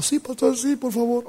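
high-pitched, pleading acted reply; imitated tearful plea. Mother agrees to pastor seeking counsel, imitated tearful plea. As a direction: play the desperate mother saying yes.

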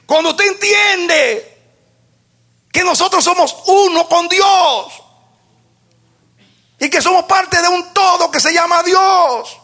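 high, loud, shouted preaching; rising preacher's shout. Acted. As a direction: drive it home at full force.

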